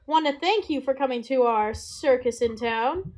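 high, loud circus ringmaster; announcer voice. Acted. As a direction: boom it out like a ringmaster. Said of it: "you hear an announcer voice go".

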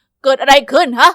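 loud, slow, irritated bark; a cranky principal booming down the hall; annoyed principal. Acted. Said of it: "มีเสียงน่ารำคาญดังขึ้น".

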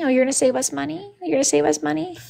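high, soft sarcastic echo of his excuse; sarcastic mock. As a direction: throw his words back at him, incredulous. Mocking Wayne's excuse.

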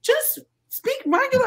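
high, loud exasperated plea. Retold or imitated. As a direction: plead in disbelief, pitch climbing way up. Exasperated retort to his talk.

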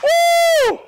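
high, loud joyful whoop; ecstatic woo. Real, not acted. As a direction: let out a joyful praise shout. Preacher's ecstatic cry.

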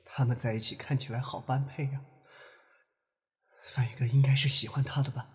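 soft, hesitant thinking aloud; quiet musing. Acted. Watching the couple together, feeling sour, quiet musing. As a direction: murmur it to yourself, with pauses.

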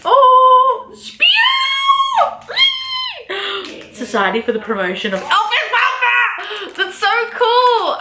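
high, delighted squealing over a badge; excited squeal. Real, not acted. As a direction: gush with real delight. Excited unboxing of a fan badge.

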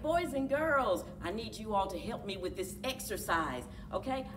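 ordinary delivery

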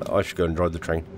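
ordinary talk

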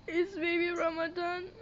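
ordinary talk, nothing marked

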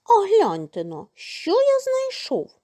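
eager rooster showing off a find; calling out. A narrator's reading. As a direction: call them over to see. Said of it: "став гукати півник".

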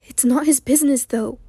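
plain reading voice

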